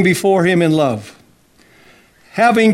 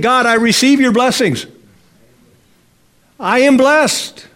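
higher, slow I am blessed; model declaration; 7 semitones higher than usual. Shown as a demonstration. Preacher modelling a confident declaration for the congregation. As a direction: model it for them. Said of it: "Why don't you try saying".

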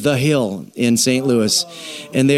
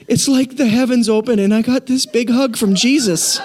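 higher-pitched, mock-emotional awe; retold rapture; 7 semitones higher than usual. Husband telling wife about the goat cheese bite, retold rapture. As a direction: gush as if overcome by a heavenly bite. Retelling tearful rapture over food.

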